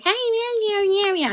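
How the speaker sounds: put-on high, cutesy Valley girl; baby Valley girl voice. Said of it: "I sounded like a baby Valley girl".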